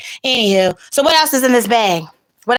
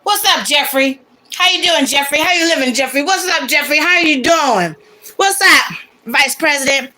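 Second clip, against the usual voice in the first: high, bright calling out; excited greeting; 7 semitones higher than usual. Real, not acted. Greeting Jeffrey on camera.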